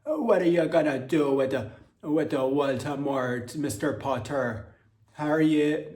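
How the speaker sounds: loud, slow mock Jamaican accent drifting Irish; attempted Jamaican accent with an Irish tinge. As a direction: ham up a Jamaican lilt that slides into Irish. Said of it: "remnants of Irish in Jamaican patois".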